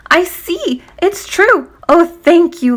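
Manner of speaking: high, joyful outcry; cried out in delight. Acted. Healed man thanking Jesus, high and delighted. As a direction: burst out with joy. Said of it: "he cried out in delight".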